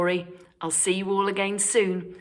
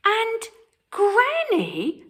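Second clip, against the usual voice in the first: put-on careful little girl; 3 dB louder, slower than usual. A narrator's reading. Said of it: "Little Red said carefully".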